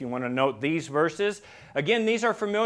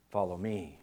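much softer and lower, an intimate invitation; soft call; 10 semitones lower, 10 dB quieter than usual. Acted. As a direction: say it gently, like a distant call. Imagined voice of Jesus calling.

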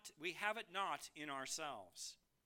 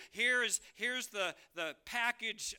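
salesman pitch; 5 semitones higher, 7 dB louder than usual. Acted. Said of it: "had a salesman come".

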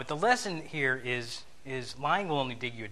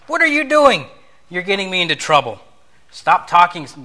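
loud, high angry outburst; heated; 6 semitones higher, 15 dB louder than usual. Pretend. Driver yelling heatedly at the passenger in the car. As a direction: snap at the passenger in a heated rage. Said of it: "very heatedly you might say".

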